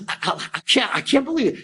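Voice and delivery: imitating a man lost for words; stammering disbelief. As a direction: stumble over the start, then jump up high. Listener stammers on hearing the news.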